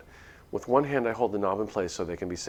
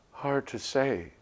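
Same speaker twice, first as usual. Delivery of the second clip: ordinary delivery, nothing marked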